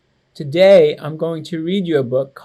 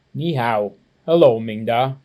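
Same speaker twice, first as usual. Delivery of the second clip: put-on a bit lower, calm old man greeting; gentle grandfather, slightly lower; lightly voiced grandpa; 5 semitones lower than usual. Grandfather greeting his grandson on arrival, calm and low.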